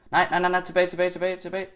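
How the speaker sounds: high, loud, urgent repetition; panicked. Real, not acted. Player scrambling back in the game, panicked.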